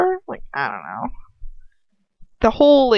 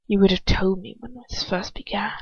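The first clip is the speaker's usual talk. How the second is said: whisper; 4 dB quieter than usual. Acted. Him whispering to her before a kiss. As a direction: murmur it close, knowingly. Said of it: "he whispers".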